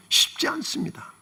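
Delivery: breathy whisper, sympathetic. Real, not acted. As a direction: whisper it as a hard truth. Pastor sighs it to congregation. (속삭이듯 공감하며)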